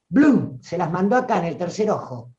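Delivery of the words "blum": punchy "bloom" as a sound effect; quick vocal burst, pitched up; imitated impact noise. Teacher mimicking words landing in the third eye, higher punchy burst.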